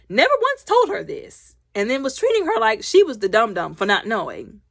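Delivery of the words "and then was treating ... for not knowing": normal talking voice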